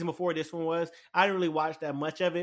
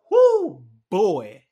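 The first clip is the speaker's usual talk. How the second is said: thrilled whoop at the finale; hyped exclamation; 4 semitones higher, 15 dB louder than usual. Real, not acted. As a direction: let it burst out of you, then land it. Hyped about last episode.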